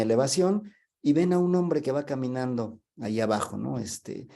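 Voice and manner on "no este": usual speaking voice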